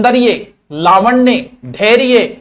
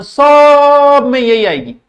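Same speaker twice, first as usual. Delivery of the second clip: slow, loud and higher, every word drawn out; emphatic; 7 semitones higher, 7 dB louder, much slower than usual. Shown as a demonstration. Teacher to exam students, slow emphatic insistence. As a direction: hammer home that it comes in every exam. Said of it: "stressing exam importance".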